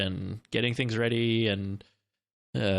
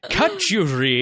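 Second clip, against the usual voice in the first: put-on Scottish accent; 5 semitones higher, 5 dB louder, slower than usual. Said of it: "a Scottish constable".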